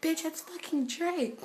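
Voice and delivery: high, slow, emphatic; excited insistence. Retold or imitated. Telling her friend it really is [name], excited. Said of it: "And I'm like".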